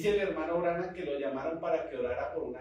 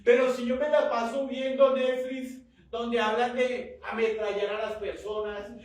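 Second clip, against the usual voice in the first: loud, higher, impassioned sermon delivery; full-force preaching; 5 semitones higher, 5 dB louder than usual. Real, not acted. Preacher urging the congregation to connect with God, full force. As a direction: exhort the congregation with rising urgency.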